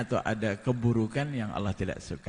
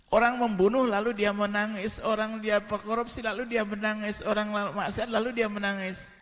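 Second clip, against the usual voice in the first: 1 fervent preaching; 12 semitones higher than usual. Acted. High preaching build.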